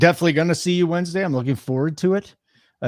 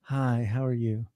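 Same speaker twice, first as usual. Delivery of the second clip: imitating soothing talk; 2 semitones lower, 6 dB quieter than usual. Girlfriend greeting her online audience, imitated soothingly. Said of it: "she talks to you soothingly".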